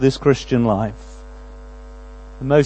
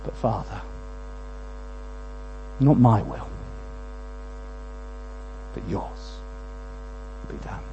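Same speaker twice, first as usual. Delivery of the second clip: much softer, fading to a whisper; hushed surrender; 6 semitones lower, 11 dB quieter than usual. Acted. Jesus yielding after his anguish, fading whisper. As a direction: let it go in a breath.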